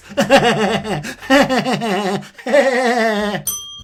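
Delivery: a high, loud disbelieving laugh; incredulous laugh. Real, not acted. Laughs after rant.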